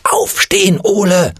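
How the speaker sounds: high-pitched urgent call; grandpa's yell. A narrator's reading. Grandpa orders Ole out of the puddle, high yell. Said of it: "Opa schrie".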